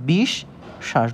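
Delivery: "বিশ" rising with held sh, then "শ্বাস"; doubled sh sound. Shown as a demonstration. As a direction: split it as বিশ, pause, শ্বাস. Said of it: "এর উচ্চারণ হচ্ছে". ("বিশ ... শ্বাস" শ দুইবার, থেমে উচ্চারণ)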